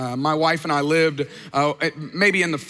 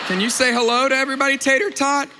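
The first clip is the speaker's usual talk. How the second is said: higher, brighter pet voice; talking to a pet; 6 semitones higher, faster than usual. Real, not acted. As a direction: coo to the dog, a little higher and faster. Talking to the dog.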